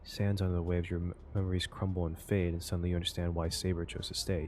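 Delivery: reading the game's narration like a storyteller; story narration. A narrator's reading. Reading the game's text aloud to viewers, storyteller narration. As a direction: narrate it evenly, drawing the listener in.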